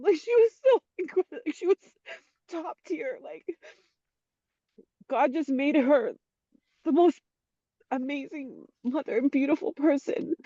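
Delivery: real tearful praise, high voice catching; crying. Real, not acted. Guest praising her late mother as top tier, crying. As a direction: sob through it with halting pauses. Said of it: "I'm crying".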